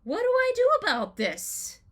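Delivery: a panicked plea, pitched far up; alarmed cry. Retold or imitated. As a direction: throw the question up in alarm. No therapist, anxiety overwhelms.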